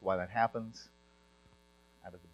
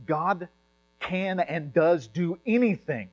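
slow, loud, higher insistence; forceful; 7 semitones higher, 6 dB louder, slower than usual. Real, not acted. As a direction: hammer each word home. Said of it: "I sound very forceful 'cause I feel it".

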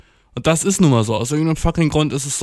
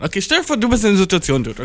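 ordinary talk

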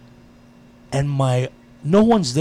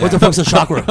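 loud, high mock bewilderment; retold incredulous yell, pitched up; baffled outburst; 4 semitones higher, 11 dB louder than usual. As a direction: demand it loudly, comically clueless.

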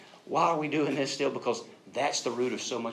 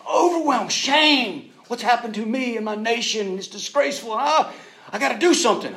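high, loud dramatized outrage; overwhelmed, angry; 11 semitones higher, 9 dB louder than usual. Retold or imitated. Preacher voicing defeated soldier's shame, loudly overwrought. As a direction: cry out the shame, hammed up. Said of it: "he's overwhelmed, he's angry, he's confused".

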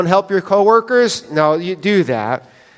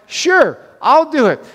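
higher, sing-song sure; mock eager agreement; 8 semitones higher than usual. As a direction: fake cheerful yes, knowing better. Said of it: "I'm like".

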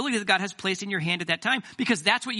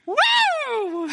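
a high, loud whoop; sudden high whoop; whooping shout; 5 dB louder than usual. Real, not acted. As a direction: let out a big joyful whoop. Exuberant outburst after joke.

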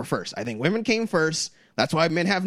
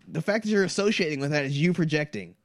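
normal talking voice

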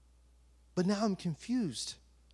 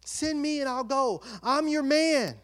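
acted-out fervent pledge; eager devotion; 3 semitones higher than usual. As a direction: offer yourself to God with mock-heroic zeal. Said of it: "Like, I'm so emotional".

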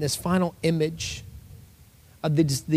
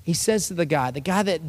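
normal talking voice

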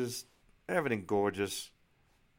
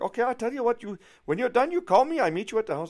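high, loud, brisk imitation of a German accent; German realtor; 14 semitones higher, 8 dB louder than usual. German realtor arranging to meet at the house, loud imitated accent. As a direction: imitate a take-charge German woman. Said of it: "tell by the accent she's obviously from Germany".

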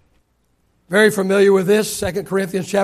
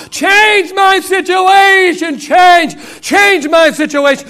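pleading Paul; 9 semitones higher, 6 dB louder, slower than usual. Acted. Paul begging God, preached.

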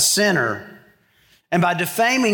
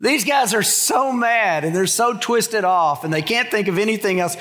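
ordinary talk

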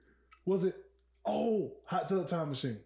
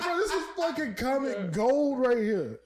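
laughing amazement; 6 semitones higher, 4 dB louder than usual. Retold or imitated. Telling friends about the skit, laughing in awe. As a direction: gush through laughter. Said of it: "I was like".

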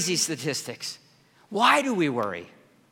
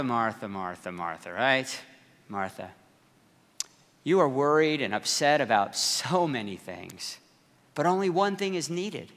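softer and lower, gentle; compassionate Jesus; 4 semitones lower, 5 dB quieter than usual. Acted. Jesus gently calming worried Martha, soft and compassionate. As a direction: soothe a worried friend. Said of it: "looking at her with compassion and concern".

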